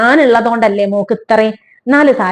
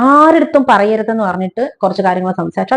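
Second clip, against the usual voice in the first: usual speaking voice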